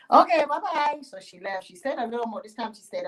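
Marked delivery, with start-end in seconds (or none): 0.1-1.0 sing-song farewell. Acted. Yemonja leaves the farmer.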